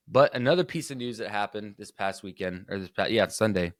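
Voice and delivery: ordinary talk, nothing marked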